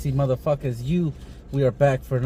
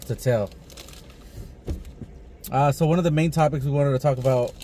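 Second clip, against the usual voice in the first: usual speaking voice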